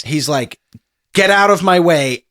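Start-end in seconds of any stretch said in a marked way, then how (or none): 1.1-2.2 loud, impatient order; irritated command, pitched up; unhappy soldier. Acted. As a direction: push them aside with a raised, annoyed voice. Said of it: "He's not very happy with that".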